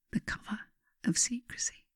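soft hushed whisper; secretive whisper. Acted. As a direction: hush it like a shared secret. Magic needs secrecy.

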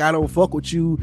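ordinary talk

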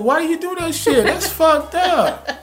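very high-pitched, hammed-up outrage; comic high-voiced complaining; mock indignation. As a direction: squeal in mock protest, breaking into laughter. Acting out an indignant reaction.

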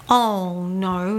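lightly imitated broad Scottish accent, concerned; a hint of a Scottish policeman's brogue. Policeman finding the stranded girls, mild broad Scottish accent. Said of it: "in his broad Scottish accent".

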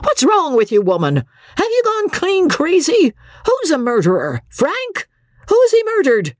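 high, exasperated tirade; exasperated outburst. A narrator's reading. Husband snapping angrily at his frantic wife. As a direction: lose patience and rail at her. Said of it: "Andy's patience snapped".

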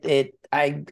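ordinary delivery

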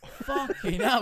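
high, drawn-out exclamation with laughter; real astonishment. Real, not acted. Fighter just got dropped, real astonished outburst. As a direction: stretch the words out in amazed glee.

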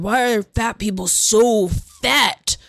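slow, drawn-out little kid imitation; put-on toddler voice, chin squashed down; toddler with tucked chin. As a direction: mimic a small child puffing herself up. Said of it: "she said it in that voice".

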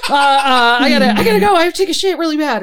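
loud, high, stammering mock panic; panicked excuse. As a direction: blurt a desperate excuse, voice shooting way up. Panicked excuse to escape, acted out.

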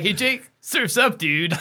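high, slow surfer-dude voice through laughter; giggling surfer bro; laughing surfer dude. Retold or imitated. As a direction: play a laid-back surfer, laughing. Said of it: "I'd be like".

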